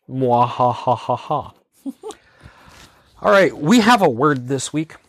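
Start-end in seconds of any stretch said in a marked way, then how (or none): 0.1-1.5 slow mock evil cackle; hammed-up villain laugh; mad scientist laugh. Evil laugh after email invite.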